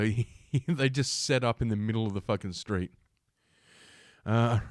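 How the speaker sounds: ordinary delivery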